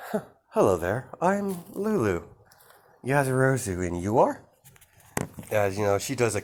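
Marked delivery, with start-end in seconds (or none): 0.1-4.4 put-on sweet greeting to child. Vampire greets a little girl.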